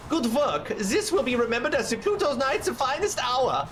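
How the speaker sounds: put-on high, pompous German-accented knight; Steiner. Knight praising his men's finest hour, pompous German accent. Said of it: "Steiner is just such a".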